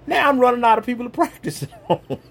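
high, quick, breaking into a laugh; real chuckle through the words. Real, not acted. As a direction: laugh at your own predicament. Laughs at his own predicament.

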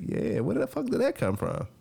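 high-pitched retold bewilderment; baffled disbelief. Reacting to her morning apology, high-pitched bafflement. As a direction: ask it high, thrown for a loop.

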